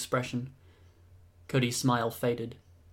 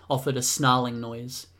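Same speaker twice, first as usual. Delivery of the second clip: ordinary delivery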